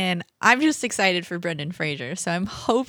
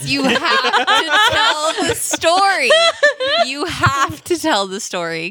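high, loud, laughing plea; excited laughing demand, drawn out at first; laughing insistence; 8 semitones higher, 5 dB louder, slower than usual. Real, not acted. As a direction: urge a friend through laughter. Begging friend for story, laughing.

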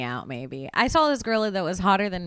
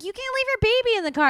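put-on scolding the mother for leaving the baby, high and fast; outraged scolding; 14 semitones higher, much faster than usual. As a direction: rattle it off in outrage.

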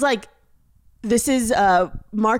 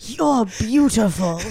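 slow, hushed mock tenderness; mock tender; 3 semitones lower, slower than usual. Telling a sad-looking man he is beautiful, mock tender. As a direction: lay it on tenderly. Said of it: "I told him".

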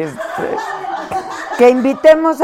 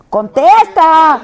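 demanding shout; 8 semitones higher, 7 dB louder, much slower than usual. Pretend. Demanding an answer.

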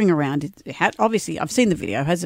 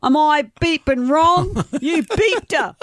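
high, slow mock outrage; retold angry accusation; 9 semitones higher, slower than usual. Host reading the woman's side of the row, mock fury. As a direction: act out an outraged partner's accusation. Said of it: "And she says".

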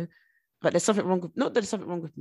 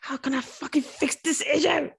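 higher, slow exasperated outburst; vexed frustration; 9 semitones higher, 3 dB louder, slower than usual. Retold or imitated. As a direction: act it out as if you can't stand not fixing it. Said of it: "I actually get vexed".

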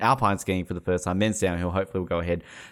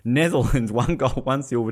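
usual speaking voice

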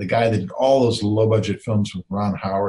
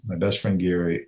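ordinary talk, nothing marked

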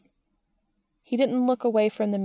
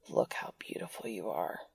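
much lower, hushed whisper; 13 semitones lower, 10 dB quieter than usual. Acted. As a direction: breathe it into his ear. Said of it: "he said low in his ear".

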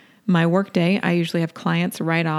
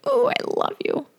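very high, airy coo of affection; cooing love to husband. Retold or imitated. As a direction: coo it up high like hugging someone. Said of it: "just say".

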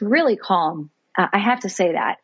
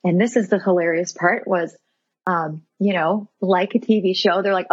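usual speaking voice

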